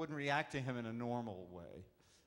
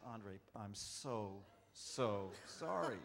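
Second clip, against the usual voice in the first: slow, softer apology; apologetic; 5 semitones lower, 5 dB quieter, much slower than usual. Retold or imitated. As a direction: say sorry slowly and softly. Apologizing to Andre after scare.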